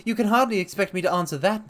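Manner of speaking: nervous patient dodging the question. A narrator's reading. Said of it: "in a nervous fashion".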